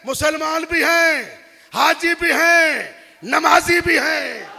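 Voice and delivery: preaching at full force. Acted. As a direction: thunder it at the mourners.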